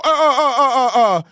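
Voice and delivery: real laughter through the words; cracking up mid-sentence; breaking into laughs; laughing. Real, not acted. Laughing at the clip.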